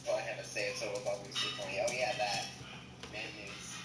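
deep pitch shifted. Acted. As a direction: drop the voice down low like a pitch shifter. Friend suddenly sounds much deeper.

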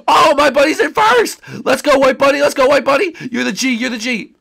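high, loud race-fan shouting; excited cheering. Acted. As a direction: cheer your racer on at full voice. Cheering the bunny in first.

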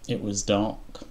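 ordinary delivery

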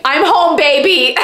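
hammed-up hometown excitement; playful exclamation. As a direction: call it out like you've just arrived. Acts out reaction to hometown name.

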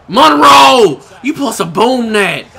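high, loud frustrated call; real exasperated shout; loud yell. Real, not acted. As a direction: bark the name at him. Yelling at his teammate.

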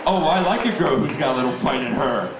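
gravelly Batman as tired drag queen. Retold or imitated. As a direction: rasp like a worn-out drag queen. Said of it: "He sounds like a drag queen".

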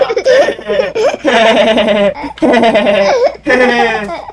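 rising drawn-out cackle; loud mocking laugh. Real, not acted.

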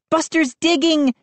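put-on high, alarmed girl's cry; Mindy crying out. A narrator's reading. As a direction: sound the alarm. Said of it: "she cried".

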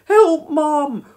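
put-on high, loud child's wail; wailing for help. A narrator's reading. Boy at the bedroom window to mother, wailing. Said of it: "wailed Norman".

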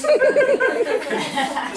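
big high burst of laughter; loud laugh. Real, not acted. Laughing at mom scolding the nurse, loud high laugh. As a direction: let the laugh break out. Laughs after mom's line.

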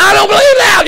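very high, loud yell; imitated furious outburst; retold angry shout. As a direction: bellow it as the outraged pastor.

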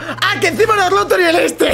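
high shout breaking into laughter; real laughter through the words; laughing shout. Real, not acted. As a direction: yell it while cracking up. Laughing at a teammate.

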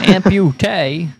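mocking drawl. Mocking the card word.

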